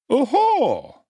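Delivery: high, loud jovial exclamation; pleased surprise; surprised oho. A narrator's reading. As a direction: undertaker greeting the beadle, surprised. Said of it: "said the undertaker".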